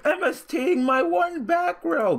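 very high, loud mock wail; hammed-up squealing protest; mocking the opponent. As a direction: imitate a salty player's drawn-out howl. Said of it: "He was like".

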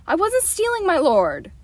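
sudden high, loud outburst; a boy blurts out his denial to the Baron; bursting denial. A narrator's reading. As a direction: blurt it out before you can stop. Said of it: "The denial burst from Will".

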